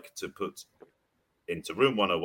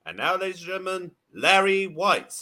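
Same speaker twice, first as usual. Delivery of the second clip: hammed-up ring announcer; stage announcer; 11 semitones higher, 4 dB louder than usual. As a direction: announce him like a boxing MC.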